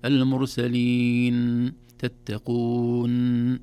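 "المرسلين" and "تتقون" with the final n dragged out; wrong demo of a stretched nun; overlong n. Shown as a demonstration. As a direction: hold the nun too long. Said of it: "إذا لا نقول".

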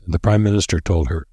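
ordinary delivery

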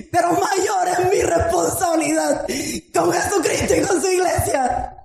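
preacher crying out to the congregation, fervent and shouting; full-force preaching. Acted.

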